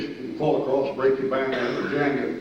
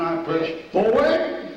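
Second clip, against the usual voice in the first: ordinary talk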